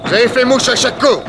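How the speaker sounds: high loud amazement; excited shout. Acted. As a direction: call out in astonishment. Fusillade, admiration. (cri admiratif)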